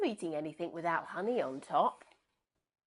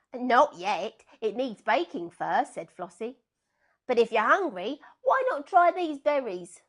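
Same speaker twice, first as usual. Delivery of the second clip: brisk sheep; 4 semitones higher, 10 dB louder than usual. Acted. Flossie answering.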